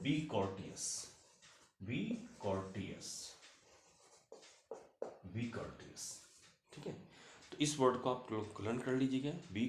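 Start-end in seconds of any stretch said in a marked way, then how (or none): 0.0-6.2 "बी कॉर्टियस" slow, getting softer each time; slow model phrase. Shown as a demonstration. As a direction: repeat "बी कॉर्टियस" slowly for learners. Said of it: "इसको सबसे अच्छा words है". ("बी कॉर्टियस" धीरे, हर बार और धीमे स्वर में)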